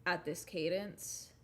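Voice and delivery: slow, measured demo of a serious narrator; serious cadence. Shown as a demonstration. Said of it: "talk at this cadence, in this voice, to sound more serious".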